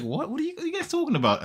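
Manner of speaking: high, fast puzzled question; mock bewilderment. Left-out friend asking what they heard, high and bewildered. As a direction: act out a baffled friend, voice jumping up high.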